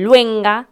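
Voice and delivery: "luenga" for lengua, the u moved into the first syllable; child's mispronunciation. Retold or imitated. Imitating chiquitos with a palabra difícil, "luenga" with misplaced u. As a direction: move the "ue" forward: "luenga". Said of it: "otra palabra difícil que dicen".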